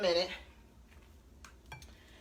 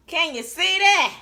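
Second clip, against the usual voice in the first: excited; 7 semitones higher, 8 dB louder, slower than usual. Real, not acted. Showing off fried chicken.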